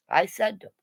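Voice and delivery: ordinary talk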